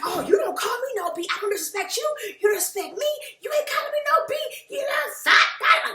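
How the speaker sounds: high-pitched mock outrage; offended. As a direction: imitate someone indignantly taking offense, pitch climbing. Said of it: "Cynthia instantly gets offended".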